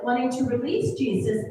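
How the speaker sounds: ordinary delivery, nothing marked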